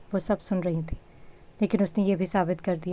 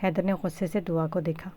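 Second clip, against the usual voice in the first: plain reading voice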